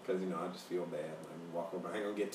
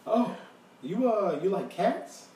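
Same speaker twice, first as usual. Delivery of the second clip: high, louder luring question; creepy stranger; 9 semitones higher, 6 dB louder, much slower than usual. Retold or imitated. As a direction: imitate an over-interested stranger, pitched up. Acting the creepy man's offer.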